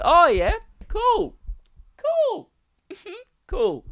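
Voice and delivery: hammed-up squeaky pretence of being fine with it; strained fake-casual. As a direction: voice climbing into a very high squeak. Replays his strained fake-casual reaction.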